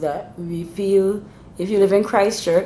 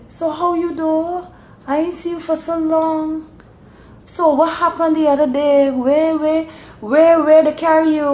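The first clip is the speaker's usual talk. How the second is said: a Bajan neighbour asking with real concern, much higher; 11 semitones higher, 3 dB louder than usual. Retold or imitated. Bajan neighbour showing real concern, high Bajan accent. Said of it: "If it's real concern, they would say".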